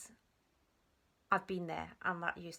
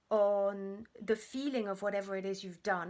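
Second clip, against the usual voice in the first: usual speaking voice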